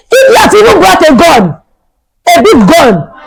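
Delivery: high-pitched, hammed-up panic; mock-horrified outcry; retold alarm. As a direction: exclaim as if reliving the fright. Retold panic at armed officer.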